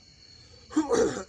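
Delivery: loud choking cough from spice; sudden hacking cough; harsh cough. Real, not acted. Choking on spicy food.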